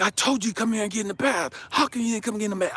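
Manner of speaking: high-pitched, frustrated parent telling off; mad scolding. Retold or imitated. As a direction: scold the child in a rush of frustration. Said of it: "I'm just going in and I'm mad".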